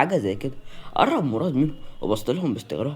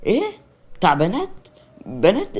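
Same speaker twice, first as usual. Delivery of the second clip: startled, rising questions; astonished; 5 semitones higher, 4 dB louder than usual. A narrator's reading. Mariam reacting to a remark at her engagement, astonished. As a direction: query it in mild disbelief. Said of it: "بصت له بدهشة وهي بتقول".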